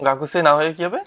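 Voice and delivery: usual speaking voice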